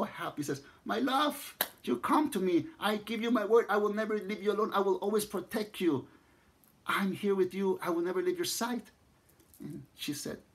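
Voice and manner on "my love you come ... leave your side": high, bright rush of joyful devotion; overjoyed. Acted. Lover greeting his beloved's spirit, overjoyed and pitched high. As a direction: pour out joy and promises, pitched up.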